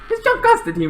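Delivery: high, squeaky disbelief; disbelieving cry. Retold or imitated. Charlie stunned by the death, high disbelieving cry. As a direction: squeal the name in shock.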